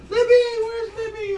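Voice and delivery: very high singsong call; calling the dog. Real, not acted. Owner calling for Libby the dog, high-pitched call. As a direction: call out in a high squeal for a pet.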